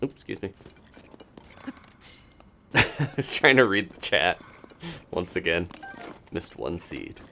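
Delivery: ordinary talk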